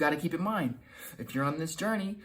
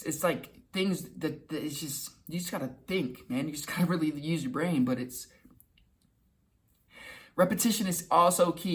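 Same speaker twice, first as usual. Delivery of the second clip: usual speaking voice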